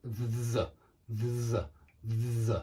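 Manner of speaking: "z" held and buzzed, low; low buzzing z demo; deep z. Shown as a demonstration. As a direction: buzz a low "z" three times. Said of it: "voicing a kind of deep z".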